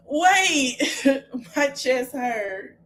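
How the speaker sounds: slow, winded words between laughs; real helpless laughter, catching breath; laughing till it hurts. Real, not acted. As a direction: struggle to talk through the laughing. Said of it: "I'm finna have tears in my eyes".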